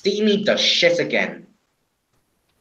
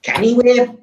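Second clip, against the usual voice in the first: character voice; 8 semitones higher than usual. Acted. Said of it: "said Spam Can".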